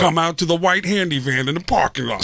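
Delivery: high, pushy hustler pitch; sketchy street hustler. Retold or imitated. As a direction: lure them in like a shady promoter. Guy pitching a fake movie.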